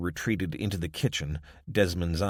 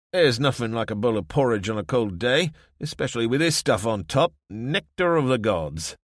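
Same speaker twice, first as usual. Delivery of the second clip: lightly voiced savouring Desmond; 5 semitones higher, 5 dB louder than usual.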